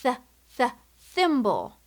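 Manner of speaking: "Th, th, thimble" with the tongue between the teeth; th sound demo. Shown as a demonstration. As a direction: stretch the "th" before "thimble". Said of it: "Did you hear that sound?".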